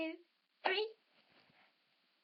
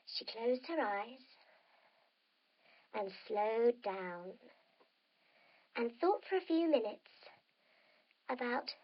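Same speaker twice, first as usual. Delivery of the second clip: bedtime wind-down; 2 semitones lower, 3 dB quieter than usual. A narrator's reading. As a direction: lull them toward sleep. Winds down into relaxation.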